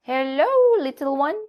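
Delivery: a bright, clucking hen; Nanny Hen. A narrator's reading. Hen greets the little donkey in a high voice. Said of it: "said Nanny Hen".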